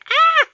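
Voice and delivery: youngest daughter's high, loud shriek of realization; startled teen shriek. Retold or imitated. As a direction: let out a sudden piercing shriek. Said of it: "she's like".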